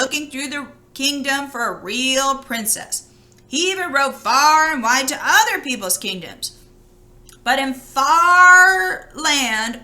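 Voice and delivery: lively read-aloud voice for a fairy tale; storybook reading. A narrator's reading. Reading a fairy tale to children, in a lively storytelling voice. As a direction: tell it like a bedtime story to children.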